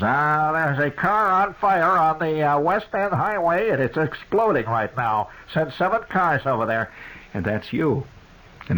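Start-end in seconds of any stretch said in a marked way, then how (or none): 0.0-6.9 police radio dispatch. Retold or imitated. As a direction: read it like a crackling radio call. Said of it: "the police radio says".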